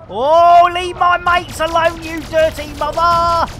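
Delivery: high, loud battle yell; worked-up, protective outburst; indignant shout. Real, not acted. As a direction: shout an enemy off your mates. Defending teammates in game.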